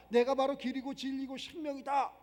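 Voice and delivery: ordinary delivery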